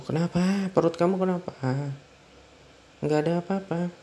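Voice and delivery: put-on frantic worry for the baby; alarmed panic. Husband panics over pregnant wife.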